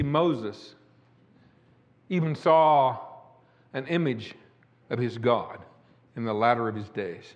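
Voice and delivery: ordinary talk, nothing marked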